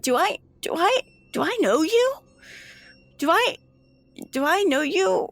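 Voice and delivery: put-on high, halting voice of a bewildered young girl; confused girl. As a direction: grope for the memory, high and unsure. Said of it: "she was just like".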